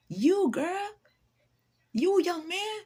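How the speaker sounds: very high, drawn-out singling out; playful insistence. Acted. Singling out a doubting stranger, playfully insistent. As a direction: lift it way up and stretch it.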